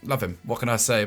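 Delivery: usual speaking voice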